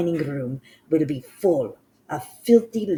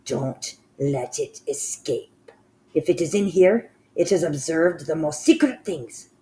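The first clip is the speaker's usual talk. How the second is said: a lightly voiced cold ruler giving orders; commanding witch queen; 4 semitones higher, 6 dB louder than usual. Grand High Witch orders the child destroyed, menacing and loud.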